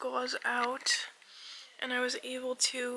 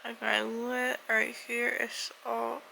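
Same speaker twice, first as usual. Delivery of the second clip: slurred through a swollen lip; 3 dB louder, slower than usual. Real, not acted. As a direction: speak with a puffed-up lip. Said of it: "my lip is so swollen".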